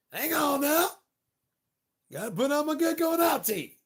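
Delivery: high, loud sing-song call; high shout. Pretend. About his going-out teeth, shouting high. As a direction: belt it out like an announcement.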